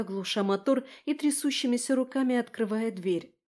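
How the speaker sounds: ordinary delivery, nothing marked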